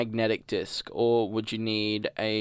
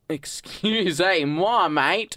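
put-on drawn-out Aussie-accented French, pitched up; French in an Australian accent; 5 semitones higher, slower than usual. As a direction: exaggerate the Australian vowels on French words. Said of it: "spoke French in an Australian accent".

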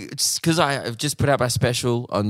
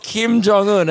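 higher, emphatic accusation; pointed naming; 8 semitones higher, 3 dB louder, slower than usual. Retold or imitated. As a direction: point and name him. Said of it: "points at me and just says".

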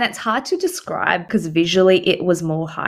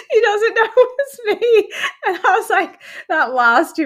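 laughing; 13 semitones higher than usual. Real, not acted. Laughing while retelling escape.

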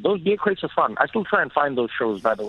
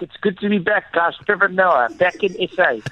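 higher, hammed-up local South African accent; thick South African accent; 5 semitones higher than usual. Trevor pretending his return home, thick South African accent. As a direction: announce your homecoming in a full South African accent. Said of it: "speak like that the whole time".

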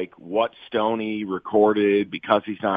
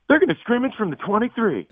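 much higher-pitched put-on voice; put-on character voice; 13 semitones higher than usual. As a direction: do a bit in a high-pitched character voice. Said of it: "There he is".

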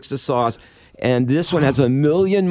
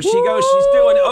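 excited; 4 dB louder than usual. Real, not acted. Cheering friend taking the shot.

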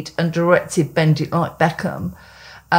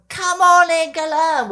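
crowd cheer; 9 semitones higher, slower than usual. Retold or imitated.

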